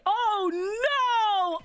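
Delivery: big acted cry of alarm; all-caps outburst. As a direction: yell it like it's written in capitals. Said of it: "All caps".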